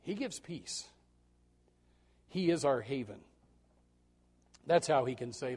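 usual speaking voice

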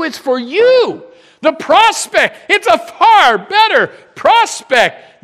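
loud, slow and lifted; excited emphasis. Real, not acted. Pastor rousing the congregation about heaven, loud and slow. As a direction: lean hard into each word with joy.

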